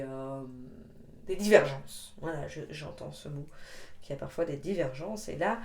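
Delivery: ordinary delivery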